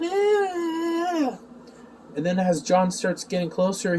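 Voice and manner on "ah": high, drawn-out mock wail; mock agonized cry. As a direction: imitate someone writhing in pain. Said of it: "Emo's there writhing on the ground".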